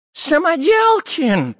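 high, slow, excited calling of a name; excited shout. Acted. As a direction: call out in an old professor's excited voice. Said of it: "взволнованно кричал".

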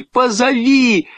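high, drawn-out plea; shouted demand. A narrator's reading. Boy insisting the girl call, shouting. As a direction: cry it out slowly and high. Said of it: "крикнул Петя девочке".

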